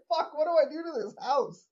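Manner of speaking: high-pitched mock exasperated wife; imagined exasperated wife. Imagining Marge's reaction to the flood.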